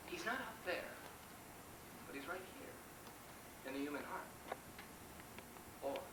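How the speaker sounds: very soft, slow pondering; hushed musing. Acted. Captain wondering where God is, hushed and halting. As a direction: drop right down and leave pauses between thoughts.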